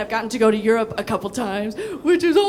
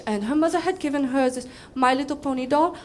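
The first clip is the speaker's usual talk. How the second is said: ordinary talk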